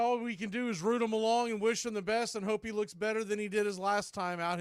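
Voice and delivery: ordinary talk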